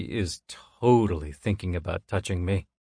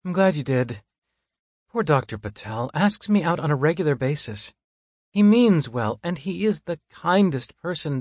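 lightly raised woman's voice; higher female voice; 7 semitones higher than usual. Acted. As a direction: explain gently, a bit higher. Monica explains, high voice.

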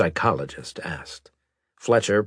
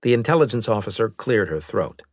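plain reading voice

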